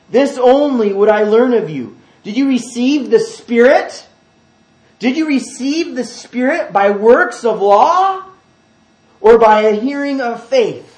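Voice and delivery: loud, high, pointed questioning; sarcastic challenge. Acted. Paul questioning the Galatians, very sarcastic. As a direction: press the questions hard with exaggerated emphasis. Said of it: "very sarcastic with the Galatians".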